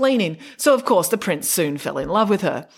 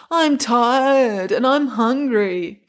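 put-on slow, whining sullen teenager; sulky teen complaining, dragged out; whiny teen; 4 semitones higher, 4 dB louder, much slower than usual. A narrator's reading. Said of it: "like a typical sullen teen".

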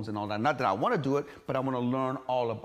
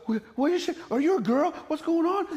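much higher, louder shocked mother; mock his mother's alarm at his shaved body; imitating mom; 7 dB louder than usual. Guest imitates his mother, shocked he shaved his body. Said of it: "she's like".

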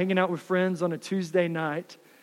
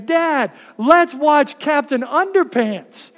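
put-on slow, higher sing-song children's chant; five kids chanting their request at dad, mock sing-song; kids in unison; 6 semitones higher, 5 dB louder, slower than usual. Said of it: "all five of my kids in unison are saying".

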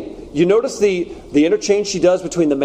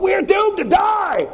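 high, slow despairing cry; panicked outcry; slower than usual. Retold or imitated. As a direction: wail it like a doomed man. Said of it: "he cries out".

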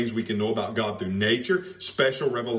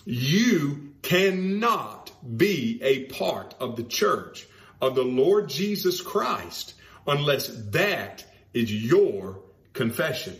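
slow emphatic point; slower than usual. Real, not acted. Slowed emphatic warning.

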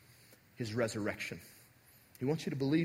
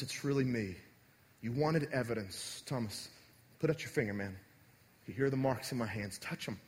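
softer, lower, gentle and reassuring; tender Jesus; 4 semitones lower, 4 dB quieter than usual. Shown as a demonstration. Pastor playing a gentle Jesus reassuring doubting Thomas. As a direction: coax him like a friend in grief. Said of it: "reread it with the appropriate tone".

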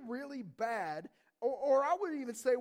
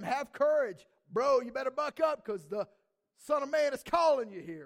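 loud, punchy pep talk; rally him with loud encouragement; urging on; 3 semitones higher, 9 dB louder than usual. Acted. Crowd calling the blind man to Jesus, loudly urging.

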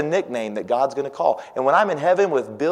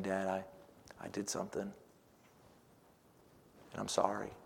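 much softer, lower, nearly whispered; hushed apology; 5 semitones lower, 18 dB quieter, faster than usual. Retold or imitated. Child apologizing to dad in a hush. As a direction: barely breathe it out, guilty. Child confessing wrongdoing, soft.

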